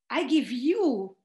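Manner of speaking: slowed, emphatic retold quote, ending on a firm fall; imitated wife. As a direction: lightly voice the wife insisting on the gift. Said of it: "My wife says".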